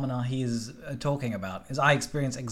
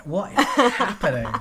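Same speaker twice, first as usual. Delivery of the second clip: usual speaking voice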